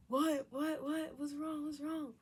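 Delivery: put-on a startled boyfriend, high and urgent; alarmed boyfriend. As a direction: mimic his frantic questions.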